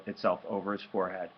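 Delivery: ordinary delivery, nothing marked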